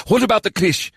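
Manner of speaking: a hint of a high stern lord; lightly voiced commander. A narrator's reading. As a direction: question the general firmly. Sajay voiced in story.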